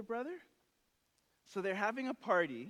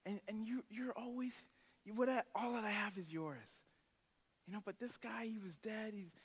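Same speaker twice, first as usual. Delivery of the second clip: soft, quiet reassuring plea; father coaxing his older son, hushed and tender; 4 semitones higher, 6 dB quieter than usual. Retold or imitated. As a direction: whisper it gently like a loving dad. Father gently pleads with son.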